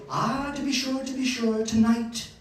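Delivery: high-pitched Irish grandmother imitation; thick Irish accent. Irish grandmother predicts the sheep's birth, thick Irish accent. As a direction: foretell it as an Irish granny. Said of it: "with her thick Irish accent".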